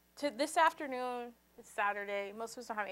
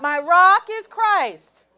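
loud, slow, stressing rock; loud declaration; 6 semitones higher, 18 dB louder, much slower than usual. Shown as a demonstration. Preacher modelling the response to the congregation, loud and slow. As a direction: shout it out slowly, leaning on rock. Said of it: "Is your rock Christ or not?".

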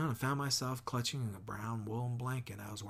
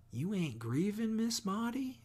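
much higher, astonished question; can't believe she isn't sad; surprised; 10 semitones higher, 5 dB louder than usual. A narrator's reading. Scout asking Miss Maudie, high and astonished.

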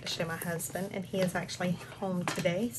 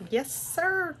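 usual speaking voice

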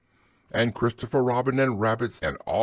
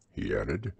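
plain reading voice

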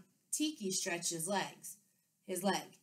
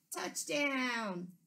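shouted; 5 semitones higher than usual. A narrator's reading. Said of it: "both boys shouted".

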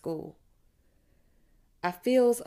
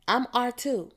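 calling out; 6 semitones higher, 4 dB louder than usual. A narrator's reading. Classmate calls out her turn.

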